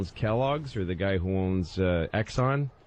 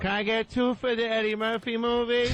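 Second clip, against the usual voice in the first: higher, eager ticket buyer; mock moviegoer; 11 semitones higher than usual. Mocking a moviegoer at the ticket counter, eager voice. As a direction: play a clueless fan at the box office.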